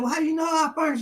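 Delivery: 1 suspicious question. Retold or imitated. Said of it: "I said".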